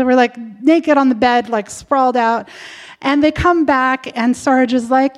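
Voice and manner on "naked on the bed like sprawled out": ordinary delivery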